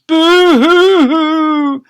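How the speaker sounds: very high, loud, slow boo-hoo-hoo; comic bawling; hammed-up sobbing. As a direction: wail like a cartoon. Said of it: "burst into floods of tears".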